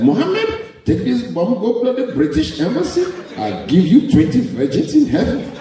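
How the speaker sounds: high, coaxing recruiter voice; hammed-up terrorist boss offering a deal; Osama recruiting. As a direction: sell the bomb job like a sly recruiter.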